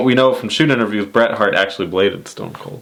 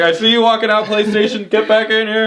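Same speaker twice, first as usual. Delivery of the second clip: higher, hammed-up pleading; mock Vince; 11 semitones higher than usual. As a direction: call the sponsor back. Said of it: "It's like".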